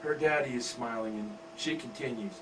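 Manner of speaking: plain reading voice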